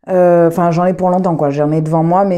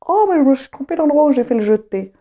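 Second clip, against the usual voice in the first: high-pitched mock realisation of a mistake; high, put-on exclamation of dismay; retold dismay; 7 semitones higher than usual. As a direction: re-enact an "oh no" moment, pitched up. Retold reaction to discovering her mistake.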